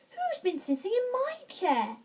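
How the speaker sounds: high-pitched mother bear voice, no shouting; middle-sized bear voice. A narrator's reading. Mother Bear at her chair, middle-sized voice. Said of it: "she said in a middle-sized voice".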